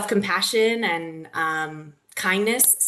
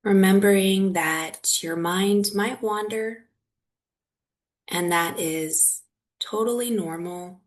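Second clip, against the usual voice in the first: guided meditation. Real, not acted. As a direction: guide them gently through it, leaving long silences.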